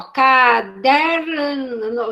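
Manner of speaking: CADERNO very slow, stretched sound by sound; child's halting reading. Retold or imitated. Teacher showing how the child read it, "caderno" slowly drawn out. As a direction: drag out "caderno" like a child decoding it. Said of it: "É do jeito que a criança leu".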